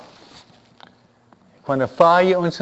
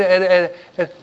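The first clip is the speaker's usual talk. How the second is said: usual speaking voice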